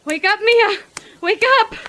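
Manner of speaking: very high, loud, desperate shouts; frantic self-command. Acted. Mia trying to wake herself from horror, frantic shouting. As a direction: shout to jolt yourself awake.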